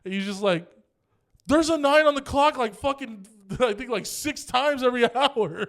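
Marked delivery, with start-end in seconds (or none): 1.5-5.7 high, loud, incredulous comeback; raised, exasperated voice breaking into a laugh; exasperated retort. Retold or imitated. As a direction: imitate a fed-up cousin pointing out the obvious. Cousin exasperated at useless answer.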